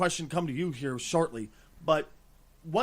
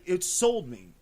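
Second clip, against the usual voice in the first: ordinary talk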